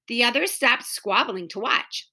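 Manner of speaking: ordinary delivery, nothing marked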